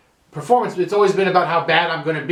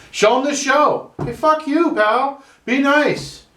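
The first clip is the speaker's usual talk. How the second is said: much higher, hammed-up telling-off; mock yell; 11 semitones higher, slower than usual. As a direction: bark it at a rude friend, high and mock-angry. Telling off bullshit friends.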